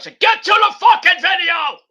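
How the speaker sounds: high, loud, slow mock yell; impatient viewer. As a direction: shout like an impatient fan demanding the video. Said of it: "I know Lauren's like".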